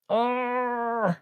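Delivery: high strained groan of annoyance; frustrated groan. Real, not acted. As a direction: let out a fed-up growl. Frustrated while ordering food.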